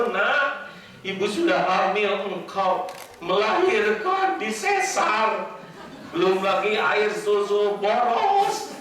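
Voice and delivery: lightly voiced nagging mom counting her sacrifices; scolding mother. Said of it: "Siang malam ibu memikirkan".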